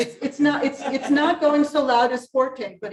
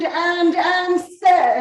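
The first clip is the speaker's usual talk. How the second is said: "and, and say" sung higher and louder with accent; 5 semitones higher, 4 dB louder, much slower than usual. Shown as a demonstration. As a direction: punch each "and". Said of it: "accent, and".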